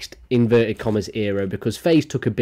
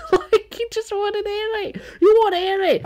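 laughing squeal. Real, not acted. Laughing after mocking her.

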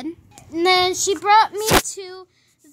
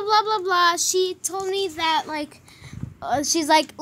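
plain reading voice

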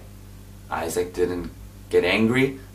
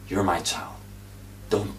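usual speaking voice

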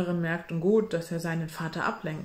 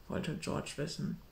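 ordinary delivery, nothing marked

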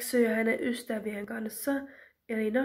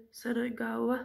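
usual speaking voice

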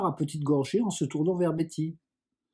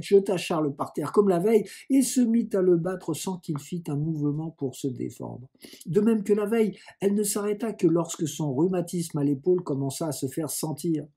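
ordinary delivery, nothing marked

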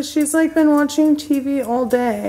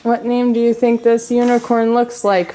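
ordinary delivery

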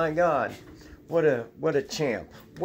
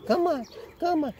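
calling the dog; 12 semitones higher, faster than usual. Real, not acted.

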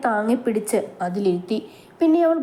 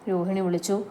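ordinary delivery, nothing marked

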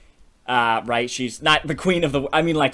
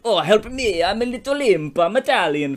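Italian imp; 7 semitones higher, 4 dB louder than usual. Acted. Little imp begs the boy for help, hammed-up Italian accent. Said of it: "I don't know why he's Italian".

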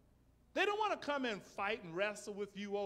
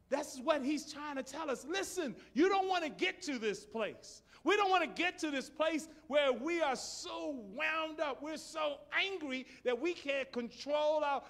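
preaching at full force; 9 semitones higher, 7 dB louder than usual. Real, not acted.

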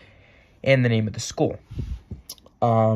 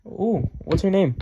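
high-pitched curious ooh; pry eagerly about the new girl; acted mom's nosy excitement; intrigued mom; 9 semitones higher, 4 dB louder than usual. Mom teases son about a girl.

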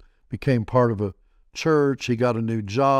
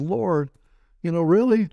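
pitched higher, disbelieving; a startled really, half laughing at the request; mock disbelief at the Lord; incredulous; 6 semitones higher than usual. Incredulous reply to God retold.

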